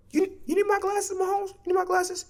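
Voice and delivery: high, quiet, teasing question; mocking. Teasing Mahomes about his eyesight, high and soft. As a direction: needle him with mock concern.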